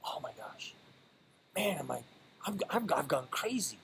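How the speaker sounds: hushed, panicked whisper; retold whispered panic at signing up. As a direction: whisper it like you can't believe what you did. Retelling panic after paying.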